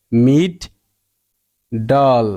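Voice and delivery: "মিড ডল" as two separate beats with a long pause between; split into syllables. Shown as a demonstration. Teacher showing the short sound in middle, syllable by syllable. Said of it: "short সাউন্ডটা বজায় রাখার জন্য".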